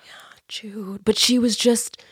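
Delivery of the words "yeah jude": fond whisper. Real, not acted. Fond sigh about Jude.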